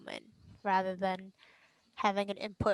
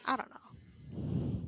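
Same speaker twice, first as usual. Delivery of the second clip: trailing off; 4 semitones higher, 5 dB quieter, much slower than usual. Real, not acted. Trailing shrug after doubts.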